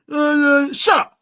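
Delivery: high, loud snapped shut up; sharp shout. Real, not acted. Host yelling at the player to shut up. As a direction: cut him off hard.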